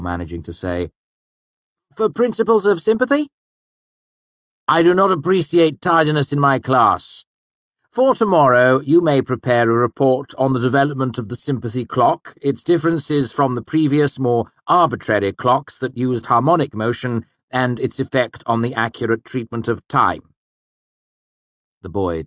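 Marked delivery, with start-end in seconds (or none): 1.9-3.3 put-on much higher, louder nervous boy; cowed student answering the master; flustered student. A narrator's reading. Gel answers, gaping. 4.7-20.3 high, loud, stern master; stern Hemme. A narrator's reading. Hemme punishes tardiness.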